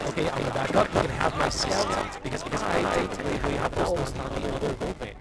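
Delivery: glitchy robotic mic; distorted mic, robotic. Real, not acted. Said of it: "you sound like a robot".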